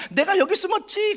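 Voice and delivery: high, loud taunting cry; teasing. Retold or imitated. Child jumping out of hiding taunts the seeker, high teasing shout. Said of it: "약 올리면서 나왔잖아요".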